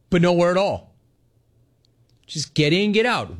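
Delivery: normal talking voice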